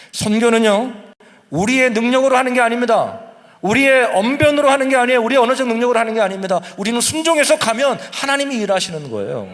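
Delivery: loud, high, driving sermon cadence; fiery pulpit proclamation, pounding each line; preaching at full force. Acted. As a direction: preach it out to the whole church.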